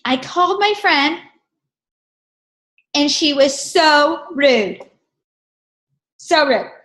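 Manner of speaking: imitating upset lady. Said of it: "he's like".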